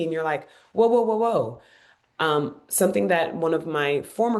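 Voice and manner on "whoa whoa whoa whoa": a light mock brake on the excitement; mock alarm. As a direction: pull yourself up short, half joking. Mock reining herself in.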